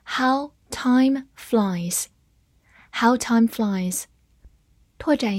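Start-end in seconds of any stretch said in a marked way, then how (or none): 0.0-2.0 drawn out, very slow; very slow reading. Shown as a demonstration. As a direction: stretch every word for learners. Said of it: "好，慢慢来读". (非常慢地朗读)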